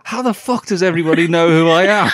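high, loud, laughing disbelief; amazed laughing. Retold or imitated. Retold friend's amazed thought.

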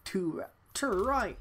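put-on a failed, slow try at an Australian accent; attempted Australian accent. Copying the game character's line in a weak Australian accent. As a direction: drawl out a bad Australian accent. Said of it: "I can't do an Australian accent".

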